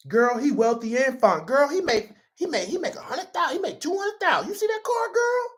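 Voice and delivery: mock gossip girl voice, pitch rising to a squeal; gossiping girlfriend. Imitating gossiping women about a rich man.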